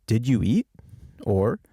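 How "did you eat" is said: "Did you eat?" lifting sharply on "eat"; rising question tune. Shown as a demonstration. Teacher shows what makes it a question, rising tune. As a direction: ask it with "eat" climbing at the end. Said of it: "My voice is going up".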